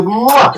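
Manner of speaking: loud, high smacking kiss noise; blown kiss. Pretend. Thanking Steve for kisses, loud playful kiss sound. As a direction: blow an exaggerated audible kiss.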